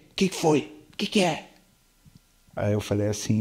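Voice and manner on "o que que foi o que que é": higher, lightly voiced demon questioning; a hint of an eerie voice asking what happened; retold demon voice, mildly raised pitch; startled possessed man. Possessed man answers the preacher.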